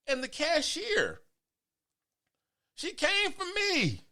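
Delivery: high, slow, drawn-out outrage; slow, high-pitched storyteller's indignation; indignant build-up. Real, not acted. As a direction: stretch each phrase high for dramatic effect. Outraged build-up to retold confrontation.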